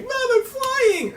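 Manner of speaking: high, slow thrilled cry; excited kid. Retold or imitated. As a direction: shout it like a giddy kid. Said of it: "he'd be like".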